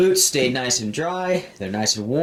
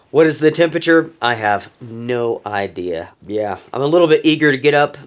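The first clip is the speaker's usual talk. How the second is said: ordinary talk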